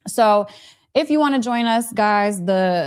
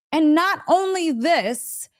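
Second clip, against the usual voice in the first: normal talking voice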